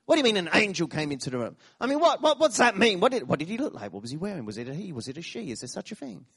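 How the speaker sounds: excited, fast, high string of questions that fades away; rapid-fire questions. Retold or imitated. Preacher voicing his own questions to the storyteller, fast and excited. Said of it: "I had a million questions".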